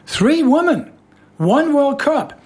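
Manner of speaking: big announcer voice; dramatic announcer. Acted. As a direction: announce it like a trailer. Radio tagline, dramatic.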